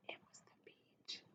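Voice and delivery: breathy mock whisper. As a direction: whisper it like a secret joke. Joke whisper correcting peach.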